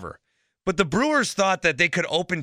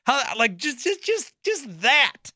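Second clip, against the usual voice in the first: exasperated sputter; 11 semitones higher, slower than usual. Real, not acted. Exasperated rant about Brewers.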